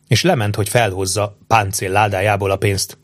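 ordinary delivery, nothing marked

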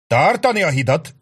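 high, loud command across the bridge; officer shouting orders in battle; shouted order. A narrator's reading. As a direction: bark the order to hold. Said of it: "kiáltotta Sharp".

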